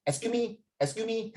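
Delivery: high, soft Singlish "Excuse me"; quick local way to pass; Singaporean accent. Shown as a demonstration. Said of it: "我们新加坡人就是用一个字".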